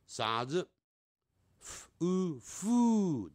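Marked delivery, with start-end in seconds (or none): 1.6-3.3 "f", then a high long "u", joined as "fu"; sounding out. Shown as a demonstration. Teacher breaking a word down for students, segmented pronunciation. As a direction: build "fu" sound by sound, higher pitched. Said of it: "但是有一个呃的音".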